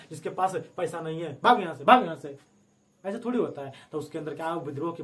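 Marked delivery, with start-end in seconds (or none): 1.4-2.4 louder, dismissive shoo-away; mock shooing off. King driving away the poor, mock dismissive shout. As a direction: play the king chasing off a poor man.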